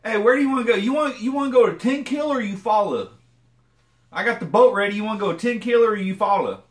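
loud, high mock Okie boater; Oklahoma twang. Imitating an Oklahoma friend planning a lake trip, loud twang. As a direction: hype it like a country boy with a boat. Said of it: "it'd be like".

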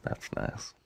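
whispered aside; whispering. Real, not acted. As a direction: whisper it under your breath. Aside to screen.

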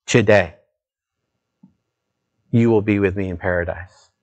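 quietly reassuring Jesus. Acted. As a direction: let the promise fall gently. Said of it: "He said".

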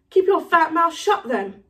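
mildly angry comeback; heated retort. Acted. Harry retorts in a quarrel.

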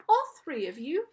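high, loud, theatrical British-sounding ferryman; strange British accent. A narrator's reading. Charon questions the newly dead children, strange British accent. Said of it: "He had a strange accent".

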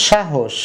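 "সাহস" said as shahosh; sh pronunciation. Shown as a demonstration. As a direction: say "সাহস" with sh at both ends. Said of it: "উচ্চারণ করলাম আমরা স এর মতো". (তালব্য শ দিয়ে "সাহস")

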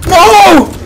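high, loud "no"; dismayed yell. Real, not acted. Player missing an amazing shot, high dismayed yell. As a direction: cry out at a near miss.

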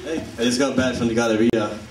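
usual speaking voice

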